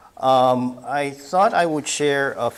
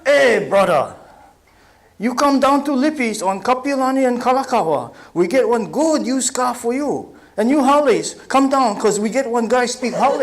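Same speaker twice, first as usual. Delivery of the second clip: loud, high Pidgin TV car-lot pitch; used car pitchman; 11 semitones higher, 7 dB louder than usual. Retold or imitated. A TV car dealer calling buyers, loud high Pidgin sales pitch. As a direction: hawk it like a local used car ad man. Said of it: "Lippy Espinda, used car television commercial".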